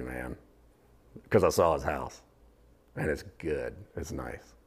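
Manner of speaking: a hint of a relaxed guy planning parties; God voiced as an easygoing host; casual party host. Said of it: "And he could just go".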